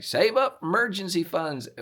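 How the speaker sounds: put-on sarcastic hillbilly accent. Mocking radio money advice in a sarcastic hillbilly accent. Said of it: "I default to a hillbilly accent".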